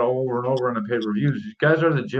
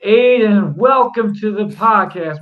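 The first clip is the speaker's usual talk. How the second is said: loud, upbeat host greeting; excited welcome; 6 semitones higher, 8 dB louder, much slower than usual. Real, not acted. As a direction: hype up the guest's welcome. Hosts greeting new guest.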